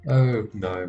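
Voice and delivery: normal talking voice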